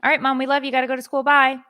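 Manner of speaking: put-on breezy kids rushing out to school; kids' cheerful goodbye. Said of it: "I see the kids".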